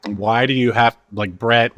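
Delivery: ordinary talk, nothing marked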